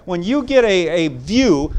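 usual speaking voice

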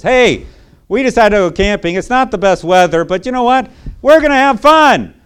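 high, loud cheery voice; cheerful upbeat parent. Pretend. Parent cheering the kids about the rainy trip, high and loud. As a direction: sell the camping trip with big cheer. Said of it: "attitude that simply says".

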